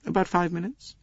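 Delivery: a lightly voiced young Englishwoman; young lady's voice. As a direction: answer coolly and politely. Young lady answers Poirot.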